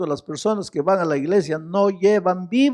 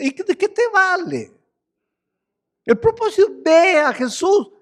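higher, pressing sermon voice; fervent pulpit preaching; fiery preaching; 13 semitones higher, 3 dB louder than usual. Acted. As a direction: preach it out to the congregation. Preacher at full force.

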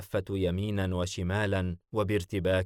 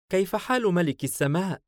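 high-pitched voice of Su'luwa; female character voice; 10 semitones higher, 4 dB louder than usual. A narrator's reading. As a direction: greet her lord in a raised, lilting pitch. Su'luwa greets Danhash playfully. (صوت سعلوة العالي)

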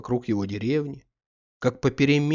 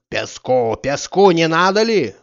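loud hawker call; sand seller's call; 5 semitones higher, 8 dB louder than usual. A narrator's reading. Sand seller in the yard calling buyers, loud street cry. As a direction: shout up to the windows to sell sand. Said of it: "раздался со двора в пять голосов".

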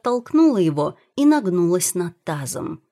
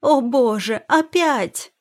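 high, loud cry of dismay; distressed wail; 7 semitones higher, 5 dB louder, slower than usual. A narrator's reading. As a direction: wail as nausea returns. Wife about to vomit again. (отчаянный высокий возглас)

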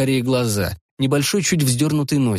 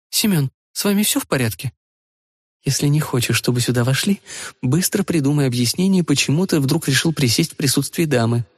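higher light female voice; young woman voice; 4 semitones higher than usual. Acted. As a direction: play the young woman, teasing. Rita speaking.

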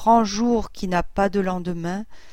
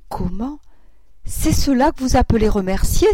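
exclaimed louder in disbelief; indignant cry; 3 semitones higher, 6 dB louder than usual. A narrator's reading. Grandfather cries out at the aunts' feeble thanks, indignant. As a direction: cry it out, outraged at the idea. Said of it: "S'écria mon grand-père".

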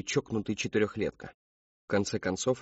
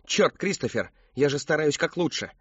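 louder, higher snapping shout; mother loses her temper at her son, shouting; angry outburst; 5 semitones higher, 4 dB louder than usual. A narrator's reading. Мать срывается на крик, громко и выше. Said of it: "выкрикнула она".

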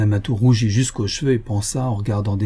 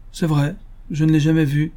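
higher-pitched sheepish admission; slightly embarrassed; 6 semitones higher than usual. A narrator's reading. Colonel caught out by the lady, slightly embarrassed. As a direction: admit it, a little caught out. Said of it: "avec un peu d'embarras".

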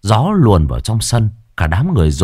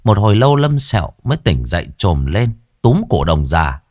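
usual speaking voice

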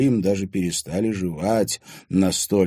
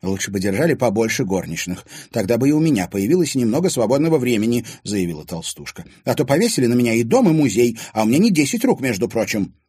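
higher, louder complaining maid; grumbling housekeeper; 6 semitones higher, 6 dB louder than usual. A narrator's reading. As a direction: complain about all the work.

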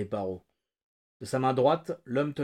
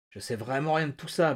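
ordinary delivery

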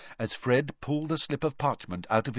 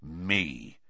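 soft, low, grim single word; 5 semitones lower, 7 dB quieter than usual. A narrator's reading. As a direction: say it grimly and quietly. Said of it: "said Moody grimly".

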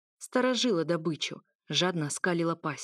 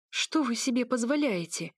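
indignant, shaky; 5 semitones higher than usual. A narrator's reading. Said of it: "возмутилась дрожащим голосом".

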